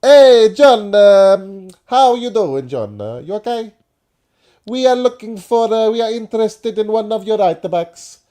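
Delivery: loud, high hammed-up Italian football director; mock Italian accent. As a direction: play a shouty Italian club boss. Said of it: "I sound like Gino here".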